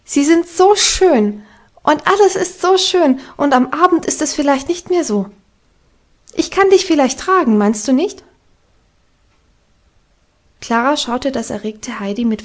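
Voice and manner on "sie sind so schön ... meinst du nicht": hoch, langsam, aufgeregt schwärmend; atemlos aufgeregt. A narrator's reading. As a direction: sprudelnd vor Begeisterung. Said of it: "außer Atem vor Erregung". (Heidi schwärmt Klara atemlos aufgeregt vor)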